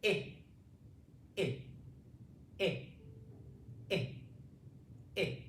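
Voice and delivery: short clipped I, I, I with pauses; short i vowel. Shown as a demonstration. Teacher models the short vowel, clipped I. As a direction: tap out each short I. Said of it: "a vowel when your mouth is open".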